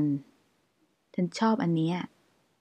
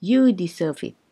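normal talking voice

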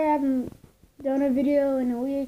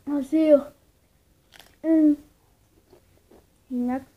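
talking while eating chips; snack at lips while speaking; chip at mouth; 4 dB louder than usual. Real, not acted. Seen 3: hand brings chip to lips repeatedly, biting during words.

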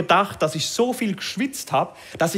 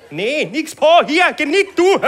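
higher, louder insistence, laughing; insisting loudly; 9 semitones higher, 6 dB louder than usual. Retold or imitated. Customer insists to the masseur, louder and higher. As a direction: say it louder and higher to make him understand.

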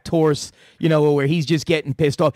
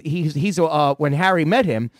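normal talking voice

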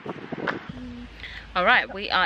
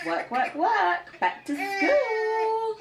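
sing-song; 10 semitones higher, much slower than usual. Real, not acted.

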